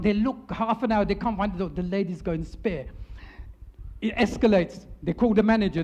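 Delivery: usual speaking voice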